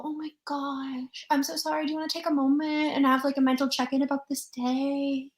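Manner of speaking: mocking West Coast people fussing over a bump, sugary and high; mock gushing West Coaster. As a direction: ham up a hyper-apologetic Californian. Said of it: "they're gonna go".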